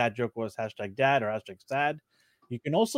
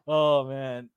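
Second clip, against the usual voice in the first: ordinary talk, nothing marked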